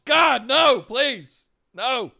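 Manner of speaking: high, loud plea in protest; pleading shout. Real, not acted. Host begging co-host to drop the joke, pleading shout. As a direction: cry out, begging for it to stop. Begs co-host to stop teasing.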